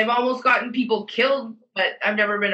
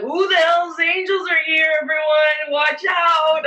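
high, drawn-out mock warning to everyone; imitating a loud drunk heckler; drunk taunting call; 6 semitones higher, 3 dB louder, slower than usual. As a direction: sing out a teasing false alarm. Drunk self loudly mocking a biker.

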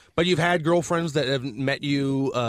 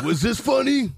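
construction worker voice; 8 semitones higher, slower than usual. Acted. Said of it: "construction worker voices".

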